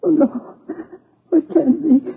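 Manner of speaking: high, sobbing refusal to accept it; grief-stricken mother sobbing in denial; crying. Acted. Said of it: "forgive me for crying".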